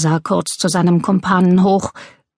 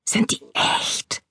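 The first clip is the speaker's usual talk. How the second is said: slow, soft whisper; awed whisper; 7 dB quieter, slower than usual. A narrator's reading. Burglar whispering to his partner over gems, slow whisper. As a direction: whisper the question in wonder.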